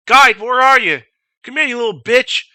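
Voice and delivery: loud mock command; drill instructor summons. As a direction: imitate an instructor hollering for a recruit. Acting drill instructor summoning the guide.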